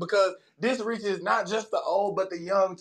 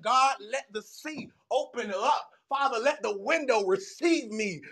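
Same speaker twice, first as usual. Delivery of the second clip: fervent preacher prayer; 5 semitones higher than usual. Pretend. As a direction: pray heaven down with fervor. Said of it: "I said".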